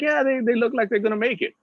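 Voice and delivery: high-pitched, acted naive compliment; imitating his clueless enthusiasm; naive encouragement. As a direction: go high, politely encouraging an unknown band. Naive compliment to famous band, acted.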